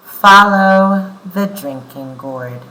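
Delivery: loud, clear read-aloud for the class; story reading. Acted. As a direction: read the book out to the children. Reads the book aloud.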